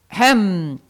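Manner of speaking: loud, emphatic throat-clearing "ahem"; phenomenal ahem. A narrator's reading. Teacher before addressing his class, phenomenal ahem. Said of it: "diede un fenomenale".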